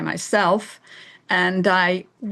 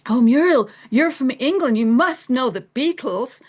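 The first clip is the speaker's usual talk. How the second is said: higher-pitched mock excited pestering; mock eager questioner; 6 semitones higher, 4 dB louder than usual. Americans quizzing an English newcomer, mock eager. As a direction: put on an eager, nosy acquaintance.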